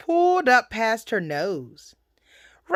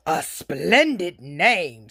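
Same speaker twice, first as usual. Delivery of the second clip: low, slow, flattering wolf; sly wolf; 6 semitones lower, slower than usual. Acted. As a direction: drop low and draw out the compliment.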